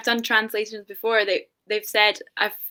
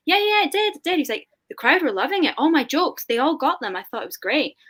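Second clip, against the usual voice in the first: higher-pitched, pleased retelling of a proud friend; mildly acted enthusiasm; 7 semitones higher than usual. Friend insisting his tasting went great, mildly acted enthusiasm. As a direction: play the friend lightly, delighted with his success.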